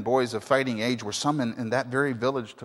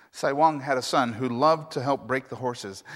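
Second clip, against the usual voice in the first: ordinary delivery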